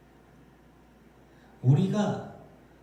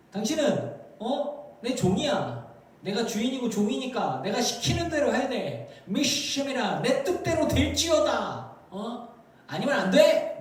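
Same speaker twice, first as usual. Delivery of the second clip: high, insistent mock commands; acting out an overbearing master; bossy master; 6 semitones higher than usual. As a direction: order God around like a servant. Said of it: "사람 혼내키듯이 막 하나님을 혼내켜요".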